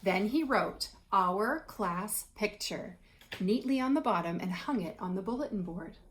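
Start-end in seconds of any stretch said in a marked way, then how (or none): 1.1-3.0 slow label reading. Shown as a demonstration. Reading the written label slowly.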